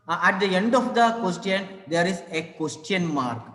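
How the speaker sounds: usual speaking voice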